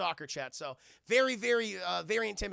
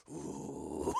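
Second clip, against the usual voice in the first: ominous growl. Retold or imitated. Menacing reaction.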